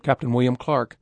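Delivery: ordinary delivery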